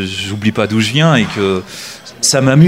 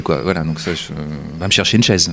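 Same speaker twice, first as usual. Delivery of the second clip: ordinary talk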